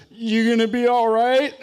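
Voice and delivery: high, loud, laughing query; Jerry stifling a laugh. Retold or imitated. Jerry asks the hurt boy, laughing high and loud. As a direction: ask it while about to bust out laughing. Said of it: "finally he goes".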